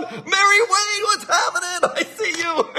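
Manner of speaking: high, drawn-out happy call-out; excited greeting. Real, not acted. Host spots a viewer joining the live stream, excited greeting. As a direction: sing out the greeting, breaking into laughter.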